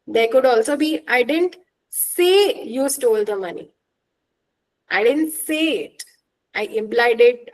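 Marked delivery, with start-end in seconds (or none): 1.1-3.7 "say" stressed, as against implying it. Shown as a demonstration. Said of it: "Depending on the word that is stressed". 4.9-6.0 "say" singled out as the denied act; stress on SAY. Shown as a demonstration. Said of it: "but I didn't speak with my mouth".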